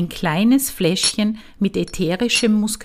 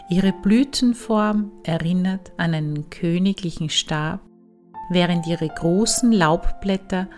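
calm, unhurried meditation voice with long pauses; guided meditation. Acted. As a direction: ease the listener into relaxation. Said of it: "Weiter geht es nun mit einer Meditation".

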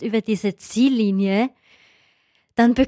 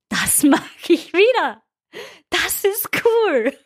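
much higher, slow and beaming; elated; 8 semitones higher, slower than usual. Retold or imitated. Runner crossing the finish line, elated and higher. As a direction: savour the win, drawn out. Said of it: "und du denkst dir".

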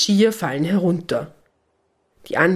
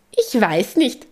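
said with a broad grin; cheeky, grinning shrug; 7 semitones higher, slower than usual. A narrator's reading. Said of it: "grinst bis über beide Ohren".

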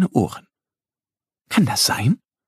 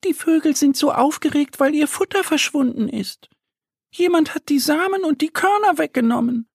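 much higher, small duck voice explaining; little duck voice. A narrator's reading. Annie the duck tells Mo about the birds, high duck voice. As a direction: chirp it like a tiny duckling. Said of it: "übersetzt sie weiter".

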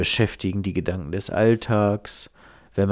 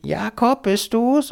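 put-on a much higher, rising old lady's voice; old grandma; 13 semitones higher, slower than usual. Grandma on the phone asking if it's her grandson, high and hopeful. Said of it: "Und dann sagt die Oma dann natürlich".

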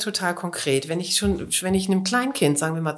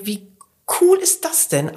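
excited; 3 semitones higher, 4 dB louder than usual. Retold or imitated. Parent thrilled by a tip.

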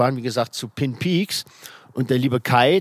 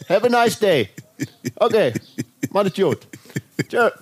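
calling out; 5 semitones higher than usual. Real, not acted. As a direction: call out across the garden. Calling out to neighbor.

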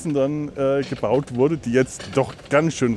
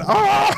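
scream; 5 dB louder than usual. Real, not acted. Scream in a curve.

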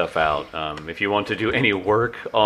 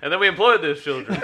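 laughing; 8 semitones higher, faster than usual. Real, not acted. Laughing joke.

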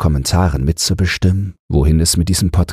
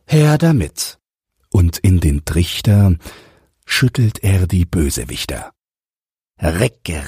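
storybook narration. A narrator's reading. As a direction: read the rhyming tale to listeners. Reads a verse story aloud.

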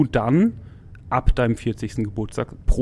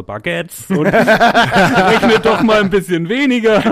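higher, louder, slow mock scolding through laughter; chuckling self-reproach, pitched up; laughing inner voice; 9 semitones higher, 9 dB louder, slower than usual. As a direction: laugh while playing your own inner voice.

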